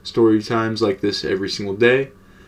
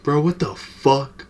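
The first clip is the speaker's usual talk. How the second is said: slow, higher stunned friend voice; stunned disbelief; 5 semitones higher, slower than usual. Retold or imitated. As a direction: drag it out in disbelief. Friend finds the weed gone.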